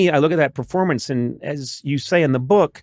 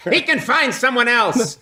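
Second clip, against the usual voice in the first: higher, lightly acted brush-off; playful raised-pitch quip; mock movie line; 10 semitones higher than usual. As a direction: toss it off as a comic film line. Acting out joke Casablanca ending.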